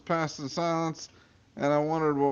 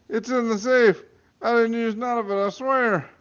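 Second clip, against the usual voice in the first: high pleading voice; 10 semitones higher than usual. Acted. Man pleading with Vinny.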